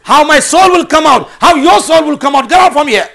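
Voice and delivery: high, loud, indignant challenge; imitating an outraged shopkeeper at full volume; angry retort. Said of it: "he got angry".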